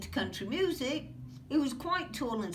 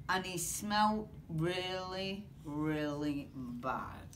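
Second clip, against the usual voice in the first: disgusted; 7 semitones lower, 5 dB quieter, slower than usual. A narrator's reading. Donkey smells bad.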